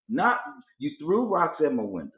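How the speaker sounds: normal talking voice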